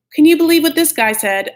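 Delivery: put-on high, loud stab at Yolanda's accent; hammed-up Yolanda accent attempt. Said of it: "I try to do Yolanda's accent".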